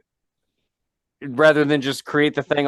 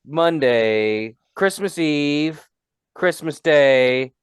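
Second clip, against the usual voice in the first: slow, dragging list of days; slow drawn-out list; much slower than usual. Pretend. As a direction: drag each day out. Listing workdays slowly.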